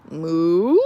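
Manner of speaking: loud mooing cow asking; questioning moo. Acted. Teacher voicing the cow for the class, loud questioning moo. Cow sound effect in story.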